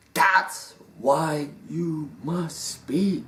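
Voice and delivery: very slow, drawn-out pronouncement; solemn commanding voice. Retold or imitated. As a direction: stretch each word out like a voice from beyond. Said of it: "then that voice says".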